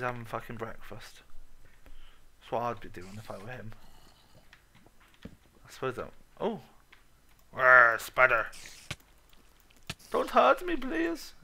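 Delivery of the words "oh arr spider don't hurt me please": loud, high hammed-up terror; mock panic. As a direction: beg the spider in a hammed-up squeal. Mock fear of spider.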